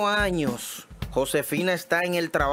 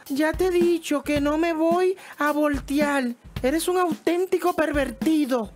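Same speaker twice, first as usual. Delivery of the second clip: much higher, scolding woman; indignant wife; 11 semitones higher than usual. Acted. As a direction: tell him off, high and offended. Wife refuses again, annoyed.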